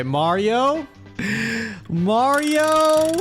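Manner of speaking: usual speaking voice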